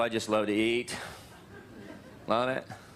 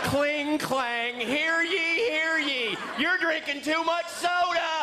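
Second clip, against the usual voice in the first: town crier; 14 semitones higher, much slower than usual. Pretend. Comedian mocking big soda drinkers as a crier. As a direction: ring the bell and proclaim it like a town crier.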